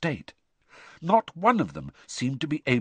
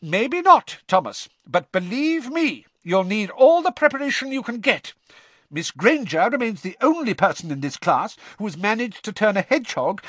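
high, loud stern professor; a strict teacher's warning; McGonagall; 11 semitones higher, 4 dB louder than usual. Acted. McGonagall lectures the class.

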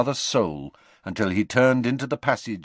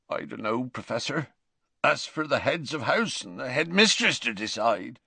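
croaked; 3 semitones higher than usual. Acted. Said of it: "raised puffy red eyes and croaked".

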